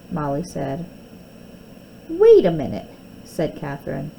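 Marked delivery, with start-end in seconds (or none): 2.1-2.9 sharp objection. Acted. Girls quarrel over the doll.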